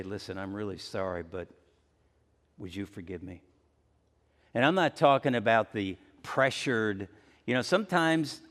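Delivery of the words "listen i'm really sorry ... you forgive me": softer, heartfelt plea fading almost to a hush on "would you forgive me?"; sincere plea. Shown as a demonstration. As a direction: ask for forgiveness humbly and gently. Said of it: "It's another to say".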